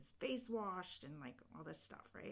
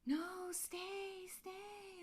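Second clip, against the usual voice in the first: pleading fairy; 14 semitones higher, much slower than usual. Retold or imitated. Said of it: "the fairies are like".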